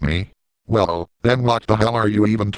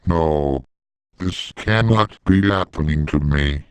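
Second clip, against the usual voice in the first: slowed malfunctioning voice. Pretend. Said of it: "How can I get my voice to return to normal".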